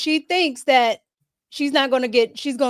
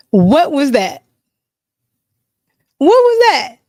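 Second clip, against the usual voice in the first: loud, disbelieving demand, jumping higher on the repeat; incredulous; 3 semitones higher, 9 dB louder than usual. Real, not acted. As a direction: ask it loudly in disbelief. Incredulous reaction to a story.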